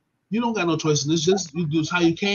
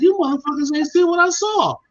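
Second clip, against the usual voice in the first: incredulous outburst; 10 semitones higher, 3 dB louder than usual. Real, not acted. Scoffing at clueless adults.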